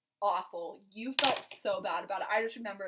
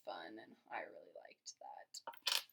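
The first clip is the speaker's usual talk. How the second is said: much lower, soft breathy whisper; 11 dB quieter, slower than usual. Real, not acted. Confessing to her husband she liked their first date, whispered. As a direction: let it fall to a tender whisper.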